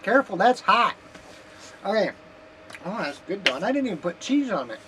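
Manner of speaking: talking through hot food; mouth full. Real, not acted. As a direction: speak round a mouthful. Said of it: "Quit talking with my mouth full".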